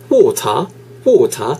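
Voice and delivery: water with no R, said like wota; British pronunciation of water. Shown as a demonstration. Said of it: "영국 건 water 이렇게".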